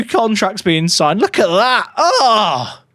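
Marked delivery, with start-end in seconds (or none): 1.2-2.8 excited. Real, not acted. New contracts signed, reacting.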